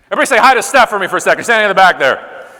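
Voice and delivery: loud, fast call to the room; rally the audience to greet her; calling out to the crowd. Real, not acted. Speaker to audience, loudly calling for a greeting.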